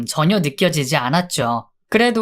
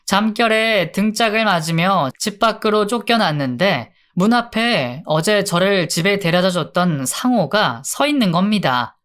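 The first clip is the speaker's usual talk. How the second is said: plain reading voice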